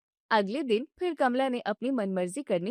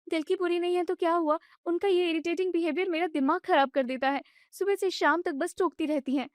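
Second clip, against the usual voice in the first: much higher, fast, exasperated retort; indignant wife echoing; 7 semitones higher than usual. Acted. Wife mocks her husband's excuse, high and exasperated. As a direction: fling his words back at him. Wife throws his words back.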